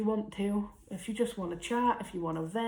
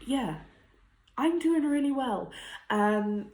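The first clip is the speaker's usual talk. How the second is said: tearful laughing; 6 semitones higher, 3 dB louder than usual. Real, not acted. Tearful, laughing through emotion.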